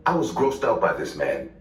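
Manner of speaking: ordinary talk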